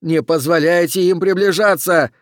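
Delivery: loud, high urgent command; shouting. Acted. Oxy warns his men to hold off creatures, shouting. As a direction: shout an alarmed warning order. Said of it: "прокричал Окси".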